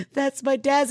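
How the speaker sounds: high-pitched mock sobbing; hammed-up tearful blurting; mock losing it. Crash victim telling paramedic about dad's name, mock sobbing. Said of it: "so then I lose it".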